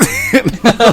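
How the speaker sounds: high loud laugh bursting out; burst of laughter. Real, not acted. Laughing at mom's brush-off, loud high cackle. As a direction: crack up at the memory.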